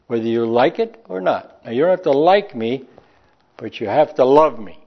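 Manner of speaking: ordinary delivery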